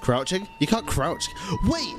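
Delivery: ordinary talk, nothing marked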